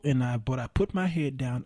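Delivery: ordinary talk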